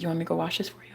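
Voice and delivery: soft unvoiced whisper of his offer; whispered quote. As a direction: breathe it like a sweet secret. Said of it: "He's like".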